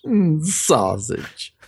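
slow, savoring moan. Acted. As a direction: relish the word slowly. Lustful savoring.

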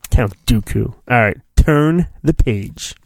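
ordinary talk, nothing marked

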